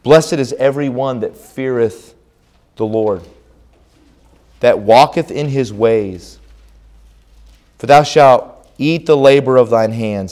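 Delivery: measured, solemn reading of the psalm; scripture reading. Acted. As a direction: read it like a lesson from the pulpit. Reads scripture aloud.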